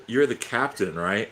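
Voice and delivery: ordinary talk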